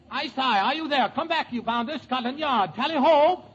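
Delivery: English father. Acted. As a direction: try a mock-incensed Englishman. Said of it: "play the part of Lady Parkington's father".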